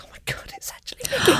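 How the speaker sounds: high, breathless whispered squeal; starstruck squeal. Retold or imitated. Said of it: "I'm a bit like".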